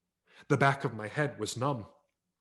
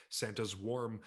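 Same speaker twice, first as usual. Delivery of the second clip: ordinary delivery, nothing marked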